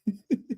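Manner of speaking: short, soft laugh; quick little laugh between shouts; amused laugh. Real, not acted. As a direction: chuckle at your own impression. Laughs at own impression.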